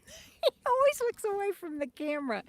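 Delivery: real laugh breaking into the words; amused laughing. Real, not acted. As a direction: say it through a giggle. Amused by her camera-shy dog.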